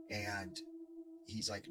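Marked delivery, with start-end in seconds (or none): none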